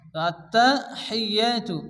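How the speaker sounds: আত্তাহিয়াতু with a breathy ha sound, doubled ত and ইয়া. Shown as a demonstration. Teacher showing the breathy হা in আত্তাহিয়াতু. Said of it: "মুখ দিয়ে বাতাস বের করে উচ্চারণ".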